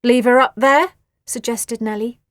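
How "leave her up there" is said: loud, cheeky; Nelly's suggestion. A narrator's reading. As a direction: say it flatly.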